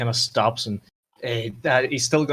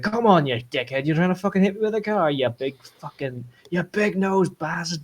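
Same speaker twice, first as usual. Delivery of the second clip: raised, aggressive taunting at a driver; angry heckler; 6 semitones higher than usual. Acted. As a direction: play a thug yelling abuse at a stranger. Game master voices a yelling thug.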